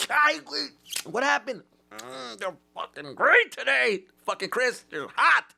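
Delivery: high-pitched, loud food ecstasy; over-the-top excited rave; hammed-up delight. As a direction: squeal it like you've burned your fingers and love it. Acted delight at hot fries.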